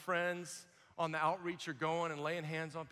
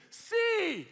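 high, loud mimicked yes; acted enthusiastic cry; eager shout; 12 semitones higher, 6 dB louder than usual. Crowd member eagerly answers yes.

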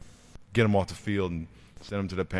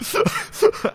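high unvoiced imitated sob; mock kid's sobbing; 6 dB louder than usual. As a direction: mimic a kid's breathless sob. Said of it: "So he's going".

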